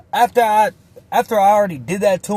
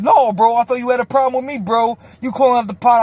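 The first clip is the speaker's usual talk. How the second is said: cornered; 7 semitones higher, 4 dB louder, faster than usual. Retold or imitated. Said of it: "He's in the corner like".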